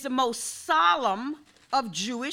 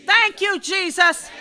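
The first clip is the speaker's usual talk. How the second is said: higher, louder shout of thanks; shouted praise; 6 semitones higher, 7 dB louder than usual. Real, not acted. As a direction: cry out the thanks to Jesus.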